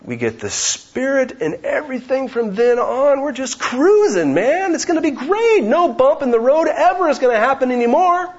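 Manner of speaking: high, eager mock voice of a carefree believer; naive believer. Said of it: "we tend to think".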